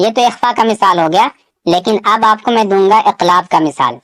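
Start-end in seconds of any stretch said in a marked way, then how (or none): none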